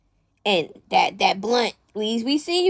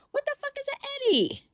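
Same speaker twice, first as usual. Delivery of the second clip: high, incredulous question; can't believe what she's reading; real confusion; baffled; 13 semitones higher, 6 dB quieter than usual. Real, not acted. Baffled by drug slang.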